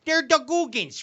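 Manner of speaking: they're the Guggens, high and loud in Chicago style; Chicago accent. Shown as a demonstration. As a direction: belt it like a Chicago fan. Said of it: "in a Chicago accent".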